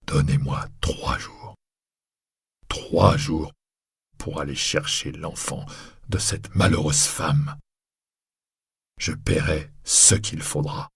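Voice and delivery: urgent low plea, almost whispered; quick and very low. Acted. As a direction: murmur it fast so only he hears. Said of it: "lui dit rapidement et très bas".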